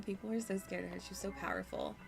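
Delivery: ordinary talk, nothing marked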